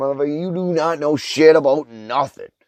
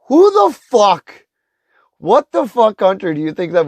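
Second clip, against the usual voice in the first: outraged shout; 9 semitones higher, 5 dB louder than usual. Real, not acted. Ranter yells at naive viewers, high and loud. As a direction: explode in disbelief.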